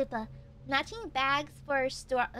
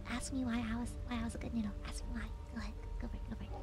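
very soft, lower near-whisper; hushed prompting; 6 semitones lower, 11 dB quieter, much faster than usual. Pretend. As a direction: coax them under your breath. Repeats the prompt in a hush.

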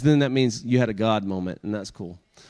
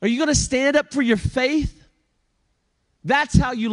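high, pressing preacher's challenge; preaching; 11 semitones higher than usual. Acted. Preacher challenging the congregation, high and pressing. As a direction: preach it up to the rafters.

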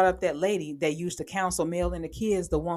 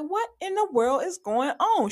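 high, incredulous exclaim; high-pitched what-is-going-on disbelief; baffled disbelief; 10 semitones higher than usual. Real, not acted. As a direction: react like you can't believe your eyes. Baffled reaction to the show.

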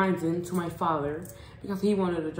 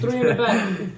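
ordinary delivery

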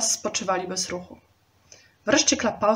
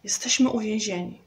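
usual speaking voice

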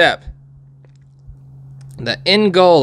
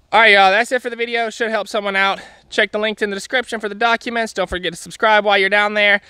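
higher, fast cheery outro; upbeat sign-off; 7 semitones higher than usual. Real, not acted. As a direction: wrap up the video with energy.

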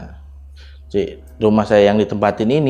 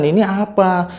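mildly alarmed, higher-pitched question; retold frightened question; 8 semitones higher than usual. Husband retells his wife seeing the footprints, mildly frightened. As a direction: imitate a scared wife, pitch lifted. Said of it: "dia ketakutan".

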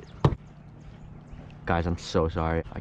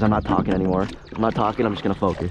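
normal talking voice

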